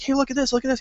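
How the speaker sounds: high, eager fan voice; imitating a thrilled fan; excited fans. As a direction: gush like an eager fan showing off. Fans excitedly showing George armor.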